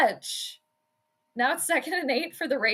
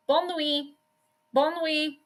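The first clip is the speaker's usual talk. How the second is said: French; 3 semitones higher, 4 dB quieter than usual. Shown as a demonstration. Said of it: "Good night".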